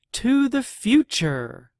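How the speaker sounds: high, loud cheer; joyful toast. Acted. Grub and Seed celebrate, high cheering toast. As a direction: raise a glass with joy.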